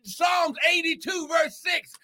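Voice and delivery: fiery preaching. Acted. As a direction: preach it to the rafters. Preaching at full force.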